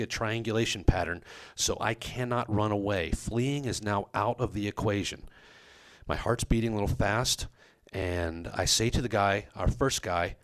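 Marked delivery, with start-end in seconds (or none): none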